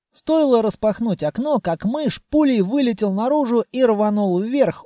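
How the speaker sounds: ordinary delivery, nothing marked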